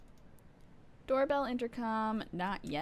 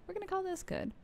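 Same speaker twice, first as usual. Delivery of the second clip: much higher, playful delivery; high-pitched repeat; 9 semitones higher, 3 dB quieter, faster than usual. Pretend. Streamer settling on a game setting, playful high repeat. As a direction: lift the whole line up high.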